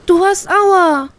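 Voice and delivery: put-on very high, slow, crying child voice; shocked little girl. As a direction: toddler upset at the wound. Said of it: "stellt sie geschockt fest".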